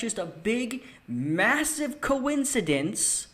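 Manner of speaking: slow, high, drawn-out sarcasm; sarcastic. Real, not acted. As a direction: stretch it out mockingly. Sarcastic remark on censorship story.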